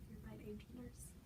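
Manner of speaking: soft, whispered admission; near-whisper, very quiet; hushed concession. Acted. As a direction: give in under your breath. Nurse gives in, hushed.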